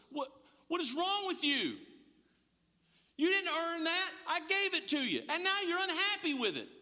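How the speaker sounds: high, loud furious dad lecture; father venting his anger at his children; angry scolding. Retold or imitated. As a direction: scold the ungrateful kids at full pitch.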